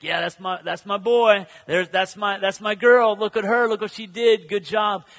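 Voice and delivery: put-on loud, beaming praise for a child; proud earthly father. Proud father praising his child, loud and beaming. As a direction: cheer on your kid like a proud dad. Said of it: "an earthly father when his son does well".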